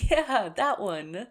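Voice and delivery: spoken through loud laughter, slow; laughing. Acted. Still laughing with a companion, confirming the joke. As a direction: laugh the words out.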